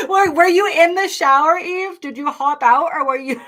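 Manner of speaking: giggling through a teasing question; laughing. Real, not acted. Teasing a viewer about leaving the shower, laughing. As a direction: laugh while you tease her.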